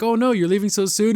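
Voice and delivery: mock dismay. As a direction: playfully protest a guest leaving. Voices hostesses protesting departure.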